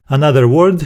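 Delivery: ordinary talk, nothing marked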